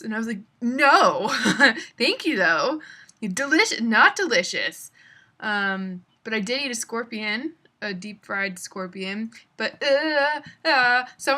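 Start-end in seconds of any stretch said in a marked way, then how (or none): 0.6-4.9 laughing refusal. Retold or imitated.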